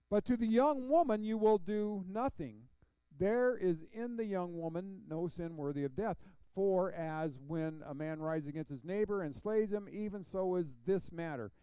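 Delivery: projected Bible reading, strong at the start; scripture reading. Acted. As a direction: proclaim the verse to the congregation. Reads scripture aloud to congregation.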